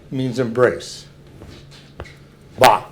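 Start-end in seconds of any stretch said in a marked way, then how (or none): 2.6-2.8 Ba said guttural and swallowed. Shown as a demonstration. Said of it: "guttural, you swallow it".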